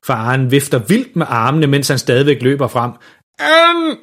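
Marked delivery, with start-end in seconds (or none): 3.4-4.0 high, garbled desperate shout; utydeligt råb. A narrator's reading. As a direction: shout it with a burnt tongue. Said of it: "råber faren". (højt, desperat råb med brændt tunge)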